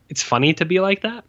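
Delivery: normal talking voice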